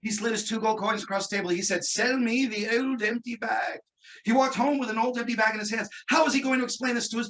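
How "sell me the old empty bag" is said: slow mock British wizard; quasi-British dialect. Wizard giving in to shopkeeper, slow quasi-British. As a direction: draw it out in put-on British. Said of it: "he had a quasi-British dialect".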